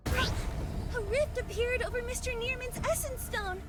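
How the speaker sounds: high, startled exclamation; alarmed cry. Acted. Hunter warning the team that a rift appeared, alarmed. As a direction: cry out the sudden news in alarm.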